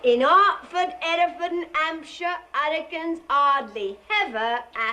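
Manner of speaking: Cockney "Hertford" as "'Artford", "Hereford" as "'Ereford"; Cockney dropped H's. Acted. Pupil failing the H drill, Cockney dropped H's. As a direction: drop each H, add one on "ever". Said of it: "you drop your H".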